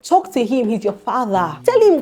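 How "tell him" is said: higher, louder urging; sudden raised exhortation; urgent call. Real, not acted. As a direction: push them to speak up. Urging listeners to pray.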